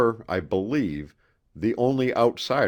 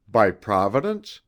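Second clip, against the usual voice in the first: a touch of dry sarcasm, a little louder; hint of sarcasm; 2 semitones higher, 5 dB louder than usual. A narrator's reading. Narrator mocking the professor's theory with mild sarcasm. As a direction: needle him lightly with the question. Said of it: "a soupçon of sarcasm in my voice".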